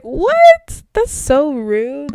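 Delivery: high shrieked what, then a slow, indignant so rude; mock outrage. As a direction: play up the shock at the insult. Retelling outraged reaction to remark.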